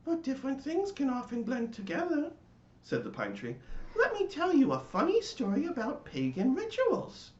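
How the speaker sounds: high pine tree voice, eager to tell a tale; chatty pine tree. A narrator's reading. The pine tree offers the latke a story, high eager voice. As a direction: lean in with a cheerful story.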